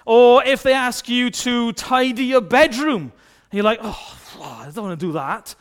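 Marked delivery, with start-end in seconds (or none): 3.8-5.6 low, soft reluctant moan; reluctant grumble.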